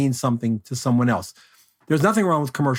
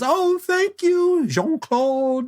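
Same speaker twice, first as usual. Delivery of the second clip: gushing models; much slower than usual. Retold or imitated. Models thanking the photographer.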